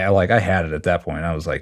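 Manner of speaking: normal talking voice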